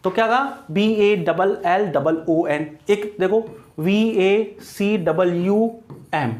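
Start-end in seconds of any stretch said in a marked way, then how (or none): 0.7-2.7 slow letter-by-letter spelling; measured classroom spelling; spelled out slowly. Shown as a demonstration. Spelling out word for students. 3.8-6.3 very slow letter-by-letter dictation; measured classroom spelling; spelled out slowly. Shown as a demonstration. Spelling out word for students.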